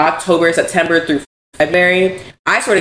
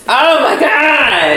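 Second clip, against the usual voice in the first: crying shout; 8 semitones higher, 4 dB louder, much slower than usual. Real, not acted. Crying over mentor's kind words.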